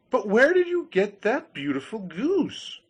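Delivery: curious grinder voice. Acted. Grinder asks.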